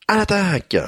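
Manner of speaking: high, ringing charge; battle cry. Acted.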